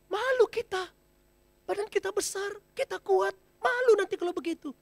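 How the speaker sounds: lightly voiced elephants scolding; ashamed elephants. Herd tells the elephant it shames them, lightly voiced.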